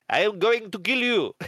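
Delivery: heavy mock French accent, pitched high; hammed-up Frenchman's threat, every word spelled out; Peter. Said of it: "in his heavy French accent".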